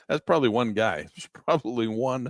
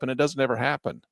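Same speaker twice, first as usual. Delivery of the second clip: usual speaking voice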